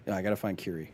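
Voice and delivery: ordinary delivery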